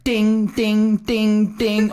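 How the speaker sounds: high, repeated notification chime; imitated phone dings. Boyfriend imitating phone as texts pour in, high dinging. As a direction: ring out like message alerts.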